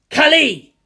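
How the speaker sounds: loud, high crowd cry; shout. A narrator's reading. Crowd in Bangor calling their god, loud shout. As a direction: yell the name out. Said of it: "they shouted in Bangor".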